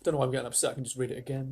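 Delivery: ordinary delivery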